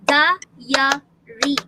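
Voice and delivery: "diary" in three loud, separate beats; syllable by syllable. Shown as a demonstration. Teacher claps out syllables for class, beat by beat. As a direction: break "diary" into di-a-ry, a clap on each. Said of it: "we can clap to see the unit".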